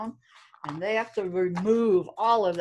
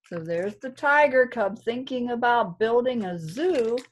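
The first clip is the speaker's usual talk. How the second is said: ordinary delivery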